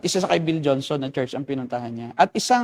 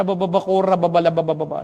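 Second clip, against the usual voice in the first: rattled-off babble, slightly raised pitch; mock speaking tongues; 2 semitones higher than usual. Pastor mimicking worshippers overcome by the cloud, babbling in tongues. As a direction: imitate ecstatic nonsense syllables from a worshipper.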